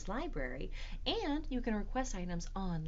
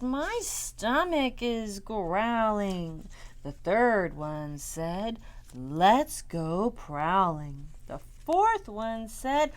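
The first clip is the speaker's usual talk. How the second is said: louder, slow, drawn-out rhyme; nursery rhyme recital; 8 dB louder, slower than usual. Acted. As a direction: chant it out big for little children.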